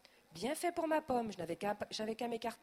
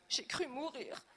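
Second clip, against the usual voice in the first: whimpering; 6 semitones higher, slower than usual. A narrator's reading. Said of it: "pleurniche-t-elle".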